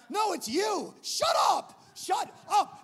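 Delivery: very high, very loud yelling; furious shout. Retold or imitated. To the panicking kid, furious shouting. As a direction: scream him into silence.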